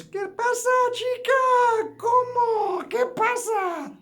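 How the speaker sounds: very high, loud cartoon voice in Spanish; silly high-pitched Spanish voice; squeaky mock Spanish.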